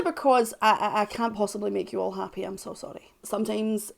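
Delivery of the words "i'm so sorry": breathy whispered sorry; whispered apology. Acted. Apologising to commenters she can't please, whispered. Apologetic aside after loud line.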